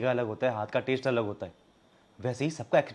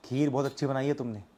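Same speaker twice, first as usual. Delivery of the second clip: usual speaking voice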